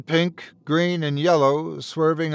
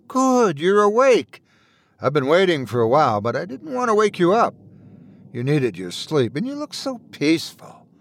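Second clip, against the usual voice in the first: cheerful wife voice; 2 semitones higher than usual. Acted. Wife speaks lovingly.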